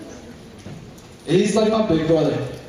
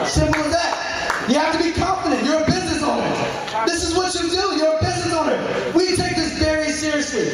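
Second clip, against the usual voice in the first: fired-up shout; 9 semitones higher than usual. Real, not acted. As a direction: preach it like a pep rally. Motivational speech building up.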